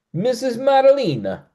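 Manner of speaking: put-on playful over-the-top Italian accent; terrible Italian accent. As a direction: toss in a deliberately bad Italian accent. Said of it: "that's my terrible Italian accent".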